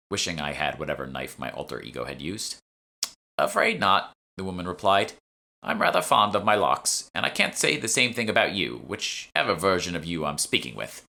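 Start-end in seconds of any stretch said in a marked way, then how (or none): 3.4-11.0 put-on haughty old shopwoman. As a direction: turn her down with dry superiority. Shopwoman refuses.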